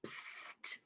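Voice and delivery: conspiratorial whispered psst; stage whisper psst. A narrator's reading. Storyteller leaning in with a whispered psst. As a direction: hiss a secret psst to the kids. Reading aloud, secret aside.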